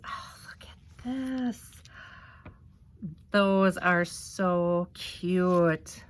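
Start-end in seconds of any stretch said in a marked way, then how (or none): 0.0-1.7 soft breathy whisper to herself. Real, not acted. Murmuring to herself over the embellishment binder, whispered. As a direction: hush it, as if sharing a secret. Quiet whispered aside while unboxing.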